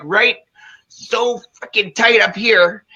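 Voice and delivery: ordinary talk, nothing marked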